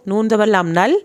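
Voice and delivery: ordinary talk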